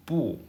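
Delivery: 不 alone, high and sharply falling; original tone of 不. Shown as a demonstration. Teacher asking students to remember it, 不 in its falling original tone. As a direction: start 不 high and drop it. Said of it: "original tone is first tone".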